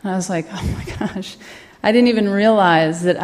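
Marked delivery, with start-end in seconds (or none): 0.5-1.4 stunned, overwhelmed hush; awed whisper. Retold or imitated. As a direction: breathe it out, barely voiced. Overwhelmed by his answer.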